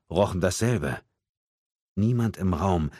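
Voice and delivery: ordinary delivery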